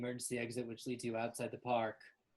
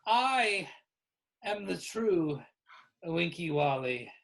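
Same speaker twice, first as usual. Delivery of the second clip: loud, halting, drawn-out reveal; Kimberly making a confession; 6 semitones higher, 8 dB louder, slower than usual. Acted. Kimberly admits being the true Winky Wally, halting grand delivery. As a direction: announce it grandly, a few words at a time.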